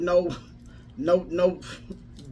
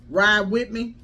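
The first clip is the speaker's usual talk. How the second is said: rising, louder preacher cadence; preaching; 4 semitones higher, 3 dB louder than usual. Acted. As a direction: preach it with building fire. Preaching build-up to audience.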